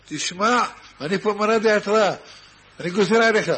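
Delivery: loud, firm rabbinic order; commanding decree. Acted. The town rabbi orders the guest to preach, loud and firm.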